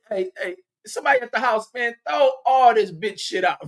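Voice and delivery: hollering orders down the line to clear her stuff; calling out. Pretend. Calling someone at the house to throw her things out, hammed-up high yell. Imagined call home to clear out.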